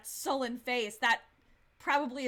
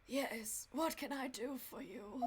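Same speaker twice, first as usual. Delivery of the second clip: slow, soft, feeble sick man; 8 dB quieter, slower than usual. Acted. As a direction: speak weakly, drawing the words out like an invalid. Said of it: "like, a sick voice".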